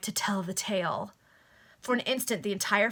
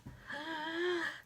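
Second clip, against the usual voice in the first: gasp; 8 semitones higher, 9 dB quieter than usual. Acted. Gasp at the barking dogs.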